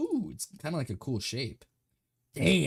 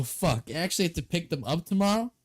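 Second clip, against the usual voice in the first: normal talking voice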